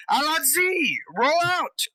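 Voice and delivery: high-pitched shouted order; called-out command. Acted. Platoon leader calls out to his men, shouted command. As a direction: call the troops to move out. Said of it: "and call out".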